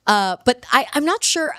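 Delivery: normal talking voice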